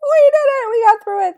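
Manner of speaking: loud, high squeak of celebration; squeaky voice. Shown as a demonstration. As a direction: cheer in a silly high-pitched voice. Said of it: "If you used a squeaky voice".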